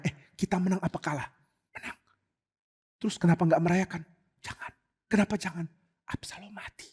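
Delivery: soft, near-silent whispers; whispered exchange. Acted. Townspeople whispering about the victory and Absalom. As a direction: whisper it so the king won't hear.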